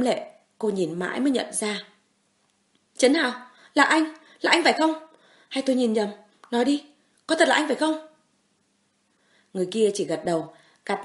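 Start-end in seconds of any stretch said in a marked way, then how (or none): 3.0-8.1 high, loud, pleading disbelief; tearful disbelief. Acted. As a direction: cry out, hardly daring to believe it's him. Woman in disbelief at rescue.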